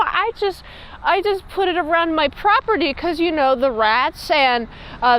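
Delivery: imitating a guilty woman talking her way out; flustered denial. Suspect woman denying to the sheriff, imitated high and flustered. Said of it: "just the way that she's saying it".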